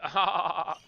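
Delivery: dopey stoned surfer chuckle; a laid-back surfer-dude laugh. Shown as a demonstration. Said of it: "Like the surfer laugh".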